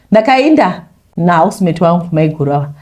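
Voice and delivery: plain reading voice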